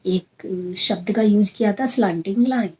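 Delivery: ordinary talk, nothing marked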